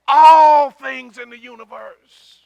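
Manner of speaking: loud, high, stretched-out proclamation; thundering emphasis. Real, not acted. Preacher answers his question about what God directs, thundering. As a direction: boom the answer out slowly.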